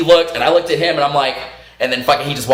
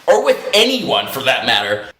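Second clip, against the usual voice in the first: usual speaking voice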